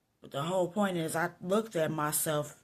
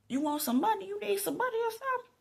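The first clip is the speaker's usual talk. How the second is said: retold offer, pitched way up; high-pitched questioning; faster than usual. Asking the woman on the train about money, high-pitched questioning. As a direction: ask in a high voice, climbing higher.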